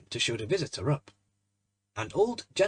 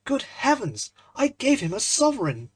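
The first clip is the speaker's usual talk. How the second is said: high, loud cry of dismay; a startled young man realising his blunder; dismayed gasp; 10 semitones higher, 6 dB louder than usual. Acted. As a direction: blurt it out, aghast at the mistake. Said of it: "the picture of dismay".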